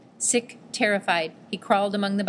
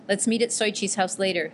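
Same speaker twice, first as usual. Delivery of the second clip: plain reading voice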